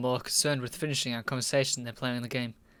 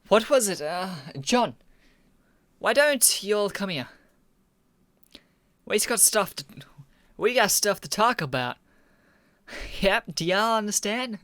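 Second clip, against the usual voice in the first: lightly put-on hillbilly accent, pitched up; a hint of a country hillbilly accent, higher; 5 semitones higher than usual. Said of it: "in some old hillbilly accent".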